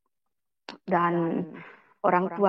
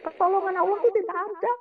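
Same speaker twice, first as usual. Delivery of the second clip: high, fast bitter cry; retold bitter outcry; 8 semitones higher, 4 dB louder, faster than usual. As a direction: spit out the accusation quickly. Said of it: "saya bilang gitu".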